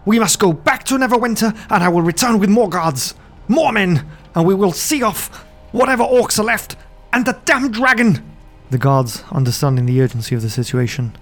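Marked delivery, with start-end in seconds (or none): 0.0-8.2 high, frantic nobleman; a terrified lord desperate to flee; panicked noble. Acted.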